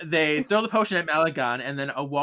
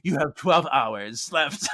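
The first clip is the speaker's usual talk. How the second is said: slow, drawn-out ultimatum; slow villain ultimatum; much slower than usual. Acted. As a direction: give the deadline word by word. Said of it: "he's like".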